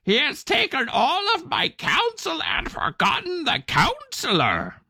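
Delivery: very high, slow croaking bird voice; croaking raven. Acted. Sulking raven croaks his grievance to everyone. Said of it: "he croaked to himself".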